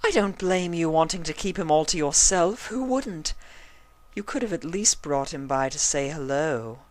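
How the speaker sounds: high, coy pony voice; flirty Rarity. A narrator's reading. Rarity flirting with Spike, high and coy. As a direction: flirt and flutter the words. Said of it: "fluttering her eyelashes".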